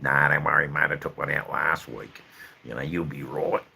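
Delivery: a light Australian accent, imitating the surgeon; lightly put-on Aussie surgeon reassuring a patient; surgeon's Australian accent. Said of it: "trying in his best Aussie accent".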